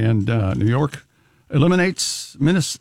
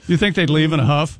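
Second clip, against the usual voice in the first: usual speaking voice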